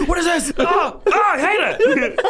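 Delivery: very high crying, laughing wail; scared dog. Pretend. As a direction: whimper like a panicked dog. Voicing the dog's panic.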